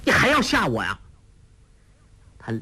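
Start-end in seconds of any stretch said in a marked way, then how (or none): 0.0-1.0 high-pitched indignant retort; vexed complaint; resentful. A narrator's reading. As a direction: snap back crossly at someone scaring you. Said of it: "恨恨地说".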